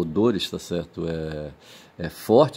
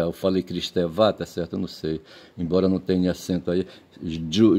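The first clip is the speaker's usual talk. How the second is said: ordinary talk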